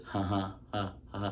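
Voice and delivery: mock canned laughter, spaced out; a deliberately hollow ha ha ha; fake laugh. Shown as a demonstration. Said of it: "It sounds like this".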